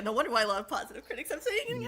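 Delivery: quoting herself through laughter; laughing. Retold or imitated. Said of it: "I'm like".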